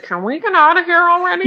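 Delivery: high, fast whiny plea; whiny wife. Retold or imitated. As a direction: beg to leave, whining. Bored wife voiced.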